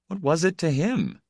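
plain reading voice

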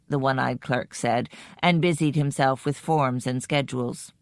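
plain reading voice

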